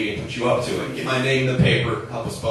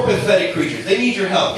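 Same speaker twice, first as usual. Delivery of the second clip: ordinary talk, nothing marked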